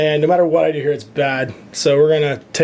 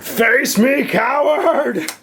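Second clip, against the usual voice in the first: gladiator; 10 semitones higher, much slower than usual. Pretend. Challenging an enemy in game.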